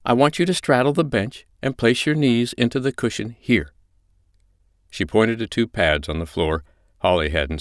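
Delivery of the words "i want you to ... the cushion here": high-pitched, controlled instructing voice; composed dominant mistress. Acted. China shows Holly the bench, in command. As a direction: instruct your submissive with easy authority.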